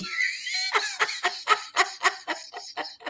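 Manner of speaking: long, very high giggling fit; real laughter. Real, not acted. Laughing at Daniel's message.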